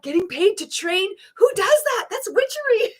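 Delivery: very high, hammed-up incredulity breaking into laughter; mock disbelief. Host reacting to paid training as if unheard of, mock disbelief. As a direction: squeal it in mock outrage.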